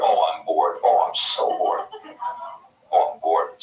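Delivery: low, groaning mock boredom; hammed-up sighs of tedium. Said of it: "You get bored".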